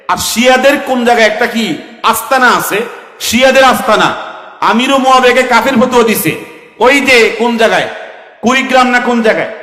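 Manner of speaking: loud, high preaching at full force; fiery preaching. Acted. Preacher rouses the gathering, loud and high. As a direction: hammer the crowd like a fiery preacher.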